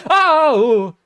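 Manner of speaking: high, loud startled oh; excited shout. Real, not acted. Fan reacting to a sudden chance, loud high shout. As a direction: blurt it out at a near goal.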